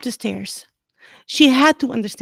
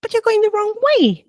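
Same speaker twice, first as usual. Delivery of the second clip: high, puzzled friendly voice; high boy voice; 12 semitones higher than usual. A narrator's reading. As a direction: point it out brightly. Neville's puzzled remark.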